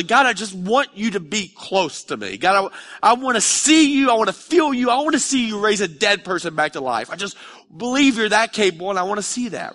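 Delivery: high, loud, pleading cry to God; impassioned prayer voiced high and loud; fervent prayer. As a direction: pour out the longing, loud.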